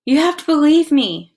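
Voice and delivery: loud, high pleading insistence; desperate insistence; insisting. Acted. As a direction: force them to believe you. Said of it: "Chet insisted".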